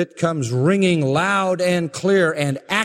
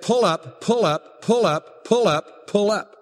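higher, evenly repeated cockpit warning; synthesized computer voice; 4 semitones higher than usual. Retold or imitated. Cockpit voice box warns the pilot before the crash, imitated computer voice. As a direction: imitate an automated alarm, identical each time. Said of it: "the synthesized computer voice in the voice box".

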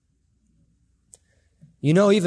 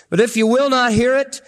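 higher and louder, "will" hammered home; raised, pleading repeat; 8 semitones higher, 4 dB louder than usual. Shown as a demonstration. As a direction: repeat it as a rising plea, "will" hit hard.

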